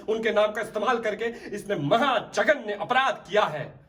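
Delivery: high, forceful courtroom denunciation; indignant prosecutor's climax; impassioned condemnation. Acted. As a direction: denounce the betrayer with rising outrage. Lawyer condemns the accused to judge.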